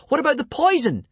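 very high, loud urgent cry; hurried shriek. A narrator's reading. Hiccup diverting the strangulator, panicked high shriek. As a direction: shriek the suggestion desperately. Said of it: "shrieked Hiccup hurriedly".

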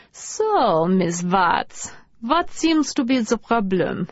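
playful doctor voice asking questions; mock therapist. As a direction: play a therapist, half joking. Lucy plays therapist, friend on sofa.

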